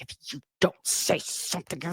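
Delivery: breathy, voiceless warning in a whisper; whispered threat. Retold or imitated. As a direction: hiss the warning so nobody else hears. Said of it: "and she was like".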